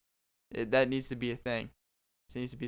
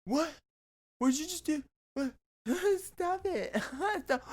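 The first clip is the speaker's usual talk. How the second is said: very high, squeaky giggling protests; 13 semitones higher than usual. Pretend. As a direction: squeal and giggle while pleading. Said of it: "They'll be like".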